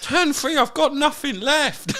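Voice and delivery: very high, drawn-out mock lament; mock despairing wail. Player mocking his own all-in games, high drawn-out wail. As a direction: wail it like a gamer who blew everything. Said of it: "and then go".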